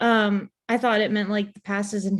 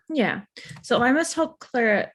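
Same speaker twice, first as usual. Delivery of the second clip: ordinary talk, nothing marked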